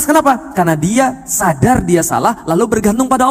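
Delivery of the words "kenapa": normal talking voice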